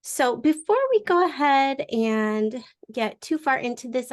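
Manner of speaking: normal talking voice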